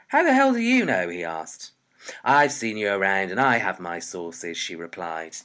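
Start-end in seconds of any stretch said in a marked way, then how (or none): 0.1-1.1 high, loud challenge; indignant outburst. A narrator's reading. Caught-out boy challenging the shopkeeper, loud indignant outburst. 2.2-4.6 low, loud comeback; firm retort. A narrator's reading. Shopkeeper putting an underage boy straight, low loud retort.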